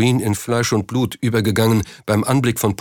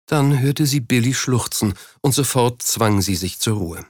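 ordinary delivery

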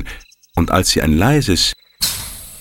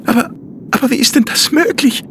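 put-on stunned, halting child voice; stammering in amazement; faster than usual. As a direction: stumble over it in wonder. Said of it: "stotterte er erstaunt".

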